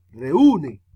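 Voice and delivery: "reúne" repeated with a loud, swelling U; stress on U. Shown as a demonstration. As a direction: lean hard on the U of "reúne". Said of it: "suena más duro la U".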